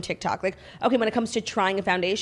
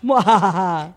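evil cackle, louder than her chat; sneering panto villain; 6 dB louder, much slower than usual. Acted. Gloating about people doing stupid things.

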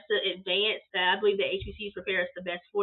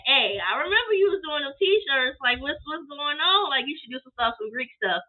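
a hint of a higher, louder sister's voice; mock big sister urging; lightly voiced big sister; 6 semitones higher, 4 dB louder than usual. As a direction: nudge her like an excited big sister. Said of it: "my big sister was like".

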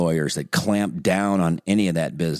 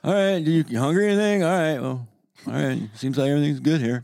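higher, breezy doting grandparent; lazy grandparent voice; 7 semitones higher than usual. Retold or imitated. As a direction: play a relaxed grandparent checking in. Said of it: "We're just like".

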